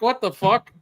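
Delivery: high, incredulous outburst; retold disbelief. Trucker stunned by the clerk's offer, high disbelief. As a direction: blurt it out, stunned.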